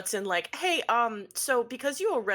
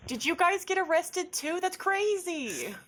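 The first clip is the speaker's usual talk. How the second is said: high, giddy greeting; giddy surprise; 10 semitones higher, slower than usual. Acted. Waving at the arrested Rivers, giddily surprised. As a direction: pitch it way up, stretch the end.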